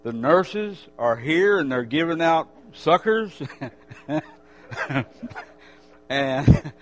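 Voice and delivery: loud, fervent preacher voice; preaching. Acted. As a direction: preach it out to the whole church. Preaching to the congregation.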